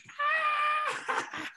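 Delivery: high shrill mock shriek of alarm; a piercing cartoon yell; imitated shriek. Friend yelling in alarm as the fight breaks out, shrill shriek. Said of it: "Keaton immediately goes".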